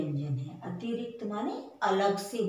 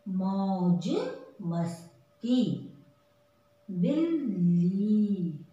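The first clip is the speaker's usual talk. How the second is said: slow, clear word-by-word reading; slow read-aloud list; slower than usual. Shown as a demonstration. Teacher to children: reading the word list slowly. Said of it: "अब हम इसे दोबारा पढ़ेंगे".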